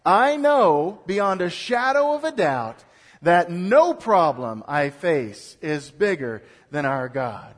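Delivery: loud, slow, full-voiced declaration; preacher rallying the congregation with gusto. Shown as a demonstration. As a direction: proclaim it with conviction, slow and loud. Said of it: "gusto behind it".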